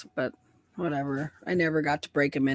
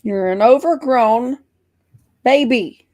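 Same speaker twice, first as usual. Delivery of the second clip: higher, louder, slow mock-scolding of the dog; teasing scold; 7 semitones higher, 6 dB louder, slower than usual. Real, not acted. Woman scolding the big dog as an overgrown baby, higher and louder.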